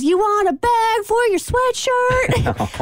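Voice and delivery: high, slow, sweetly polite clerk voice; high, drawn-out polite shop-lady voice; store clerk. Retold or imitated. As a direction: offer it sweetly and slowly. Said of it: "she goes".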